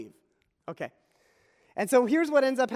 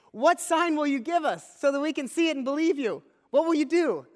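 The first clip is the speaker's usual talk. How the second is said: pushy crowd voice, pitched higher and louder; a higher, louder crowd pressing Jesus for a sign; demanding crowd; 7 semitones higher, 5 dB louder than usual. Acted. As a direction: demand proof like a skeptical mob.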